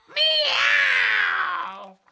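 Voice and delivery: long high loud meow. Acted. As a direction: yowl it like a cat. Girl yowls like cat.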